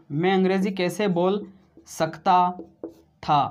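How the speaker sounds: one word at a time, long gaps; dictating word by word; slow dictation. Shown as a demonstration. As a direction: spell it out for the class to write. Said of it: "क्या बोला मैंने?".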